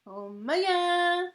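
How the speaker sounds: high, loud, drawn-out imitation. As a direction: stretch it slowly up high. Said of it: "when [name] says that. He's like".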